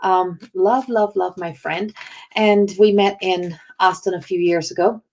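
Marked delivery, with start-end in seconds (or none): none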